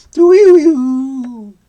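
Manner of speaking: high, drawn-out imitated tumbleweed noise; long, high wordless doo-wee-woo; tumbleweed sound effect. Imitated sound after the tumbleweed answer.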